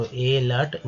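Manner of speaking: अलर्ट said as "ay-lart"; अलर्ट with the mistaken A-letter vowel; wrong pronunciation. Shown as a demonstration. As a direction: open अलर्ट on a long "ay". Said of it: "तो अलर्ट नहीं होगा".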